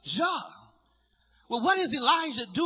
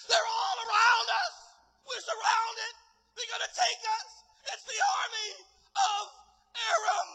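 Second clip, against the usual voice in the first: high, wailing cry of alarm; panicked servant; 13 semitones higher than usual. Acted. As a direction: whimper it in acted panic, far above your usual pitch. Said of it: "the servant is in panic".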